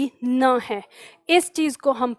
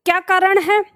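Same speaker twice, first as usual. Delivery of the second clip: normal talking voice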